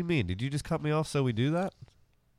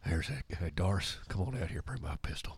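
grumbling neighbour; 5 semitones lower, 10 dB quieter than usual. Acted. S1 voices angry neighbour.

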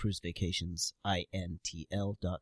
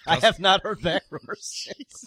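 laughing; 8 semitones higher, 6 dB louder, slower than usual. Real, not acted. Laughing through the punchline.

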